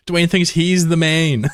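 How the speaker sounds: words squeezed out through laughter; teasing chat while cracking up; laughing. Real, not acted. Teasing a chatter about being the main, laughing.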